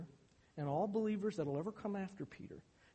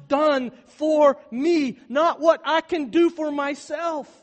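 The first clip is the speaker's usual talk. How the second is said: very loud, very slow and higher; climactic proclamation; 10 semitones higher, 18 dB louder, slower than usual. Acted. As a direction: stretch each word out at full volume. Sermon climax, then calm restatement.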